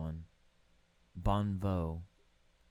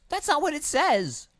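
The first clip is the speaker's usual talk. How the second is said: much higher, louder squeak; squeaky voice; 9 dB louder than usual. Pretend. Reading odd place names, a loud, very high squeak. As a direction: squeak it out in a silly voice.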